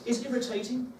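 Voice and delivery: usual speaking voice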